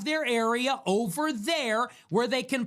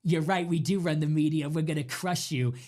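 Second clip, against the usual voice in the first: lower, sinister gloating voice; menacing mock villain; 7 semitones lower than usual. The Jekyll-and-Hyde switch mocked as a lower, menacing villain voice. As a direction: growl it like a cartoon villain who admits everything. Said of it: "And then they go".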